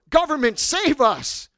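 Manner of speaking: loud, high mock appeal; crying out. Citizens appealing to the state, mock crying out. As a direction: plead upward at full voice. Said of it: "we could cry out".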